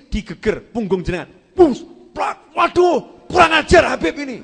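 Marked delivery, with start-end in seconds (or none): 1.5-2.5 high, loud impact noises; imitated thud and smack. Imitates the durian hitting a back. 2.5-4.4 loud, high cry of outrage; mock angry outburst. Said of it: "Marah, ma-, marah".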